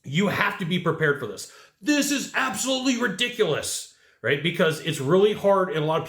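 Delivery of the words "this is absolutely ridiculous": a touch of put-on indignation, pitched up; mock outrage. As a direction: play the buyer faking shock at the price. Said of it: "the freak out".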